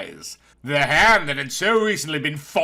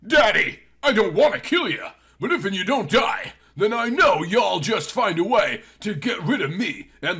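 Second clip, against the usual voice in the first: tearful; 6 semitones higher than usual. A narrator's reading. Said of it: "as her tears began to fall".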